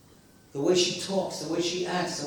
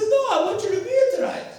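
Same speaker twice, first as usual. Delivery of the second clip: fast, very high child imitation; mock tearful insistence; pleading little boy; 3 dB louder, faster than usual. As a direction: wail it like an upset kid. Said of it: "the kid cries himself".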